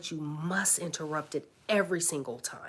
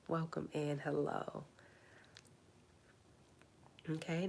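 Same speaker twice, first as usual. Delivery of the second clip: ordinary talk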